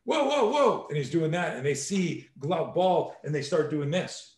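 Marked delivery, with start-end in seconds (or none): 0.0-0.9 very high, hammed-up startled whoas; a prankster faking panic in the street; mock alarm. Instructor imitating a prankster faking alarm, very high and playful. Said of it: "in the middle of the street going".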